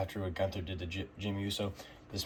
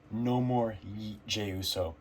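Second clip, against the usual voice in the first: drawn-out, weighty declaration, each word spaced; slow dramatic announcement; much slower than usual. Real, not acted. Vlogger dramatically announces to viewers that goofy Jey is gone, slow and weighty. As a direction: announce it slowly like a headline.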